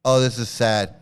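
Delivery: usual speaking voice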